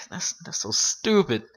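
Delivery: slow and fed up after a sigh; mild mock exasperation; exasperated. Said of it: "And I'm like".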